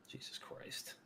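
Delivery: breathy whisper under the breath; under his breath after the story, a whispered curse; whispered aside. Real, not acted. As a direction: mutter it to yourself in a whisper.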